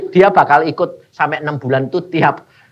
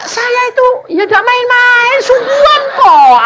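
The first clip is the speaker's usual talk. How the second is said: high, loud, laughing protest; earnest insistence; 6 dB louder than usual. Retold or imitated. Preacher insists to the pastor he is serious, high and laughing. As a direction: insist you really mean it, half laughing. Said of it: "Aku bilang gitu".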